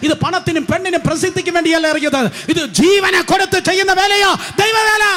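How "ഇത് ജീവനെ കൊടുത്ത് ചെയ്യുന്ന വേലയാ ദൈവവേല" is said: shout. Real, not acted. Preacher's climactic declaration.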